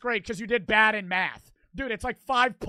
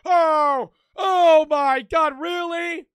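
loud, slow, drawn-out oh my God, then higher mock really; sarcastic disbelief; 6 semitones higher, 7 dB louder, much slower than usual. Real, not acted. Mocking the cartoon's plot twist, slow sarcastic disbelief. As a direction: roll your eyes at this plot. Exasperated reaction to soap plot.

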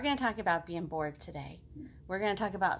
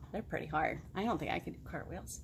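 low and soft, glum; low glum potato; 6 semitones lower, 7 dB quieter than usual. Acted. Potato admitting to the girl, low and soft. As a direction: mumble the admission. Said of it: "potato admits".

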